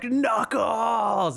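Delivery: excited shout of a name; high excited cheer; kids cheering. Retold or imitated. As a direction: yell it like thrilled kids at a movie.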